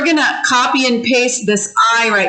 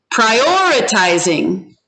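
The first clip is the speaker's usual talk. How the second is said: "prioritizing" slowly, OR pushed up in pitch; stressed second syllable. Shown as a demonstration. Teacher asks class to hear the accent, "prioritizing" stressed on OR. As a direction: lean on the OR in "prioritizing". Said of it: "now listen for the accent".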